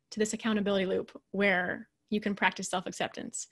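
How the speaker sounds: ordinary delivery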